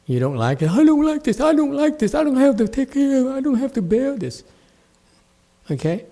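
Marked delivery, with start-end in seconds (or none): 0.7-4.4 high mocking whine; hammed-up girlish whining; whiny mock imitation. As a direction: mimic a whining student, pitched way up. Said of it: "you whine like a girl".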